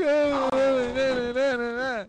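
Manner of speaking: high, drawn-out fake wailing; mock crying. Mom cries when son gets home, hammed-up wail. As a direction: imitate a mother bawling on and on. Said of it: "Mom's crying. She's like".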